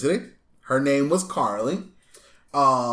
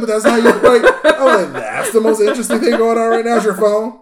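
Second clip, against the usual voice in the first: incredulous mockery; 8 semitones higher, 9 dB louder than usual. Mocking people glued to phones.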